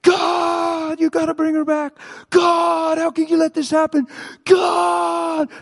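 high, loud desperate scream at God; acted-out meltdown, shouted pleas; screaming, freaking out. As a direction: scream in anguish, pitch pinned high. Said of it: "He's screaming. He's freaking out".

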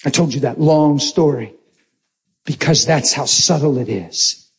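usual speaking voice

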